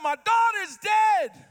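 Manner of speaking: high, loud, slow cry of grief; father's slow anguished cry; anguished thought. Acted. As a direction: let the words tear out of him. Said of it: "he thought to himself".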